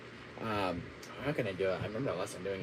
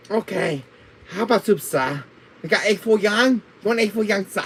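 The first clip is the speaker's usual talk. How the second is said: put-on raised pitch Chinese restaurant accent; 3 semitones higher than usual. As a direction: play a pushy Chinese waiter with a heavy Chinese accent. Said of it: "do a Chinese accent".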